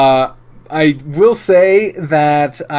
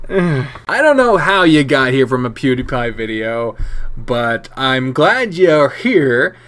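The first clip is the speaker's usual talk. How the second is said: ordinary delivery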